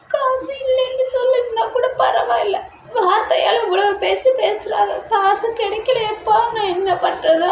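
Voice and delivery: high, breaking voice near tears; hurt and close to crying, pleading; tearful complaint. Acted. Wife tells her husband of the insult, voice breaking.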